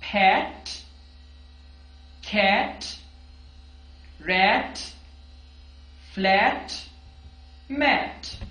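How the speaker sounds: pet cat rat said with a sharp, clear final t; crisp final T. Shown as a demonstration. Teacher modelling the final T sound for students. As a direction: pop the t at each word end. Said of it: "अब यहां पे हमारे पास टी sound है।".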